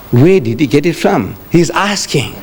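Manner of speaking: usual speaking voice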